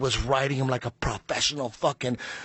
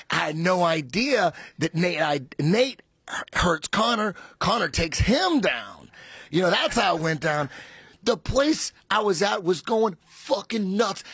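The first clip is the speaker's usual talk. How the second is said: usual speaking voice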